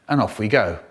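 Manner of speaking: ordinary talk, nothing marked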